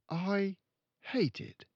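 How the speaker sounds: put-on high, earnest child; a small girl protesting; little girl voice. Matilda scolding her father, high and earnest.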